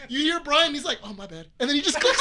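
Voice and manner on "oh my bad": soft, lower, offhand reply; meek casual apology. Retold or imitated. Brian answering his yelling teammate, quiet and unbothered. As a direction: drop right down after the yelling.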